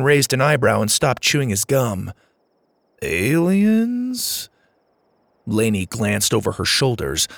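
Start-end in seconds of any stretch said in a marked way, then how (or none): 3.0-4.5 incredulous question. A narrator's reading. Driver answers the kids' alien claim, incredulous. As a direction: pitch sweeping high in disbelief.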